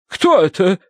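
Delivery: high, insistent lady's question; demanding. A narrator's reading. Wife asks husband about the stranger, demanding. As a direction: demand an answer. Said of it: "требовательно спросила миссис Меринг".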